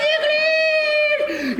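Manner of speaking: hammed-up shrill call; falsetto shout. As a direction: shriek the word high and long. Priest's final loud high call.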